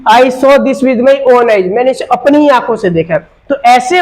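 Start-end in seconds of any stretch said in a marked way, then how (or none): 0.1-1.7 slow, words spaced, own weighted; slow stressed example. Shown as a demonstration. Teacher rereading the example slowly for students. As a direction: read it out slowly to the class. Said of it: "इस sentence में था कि".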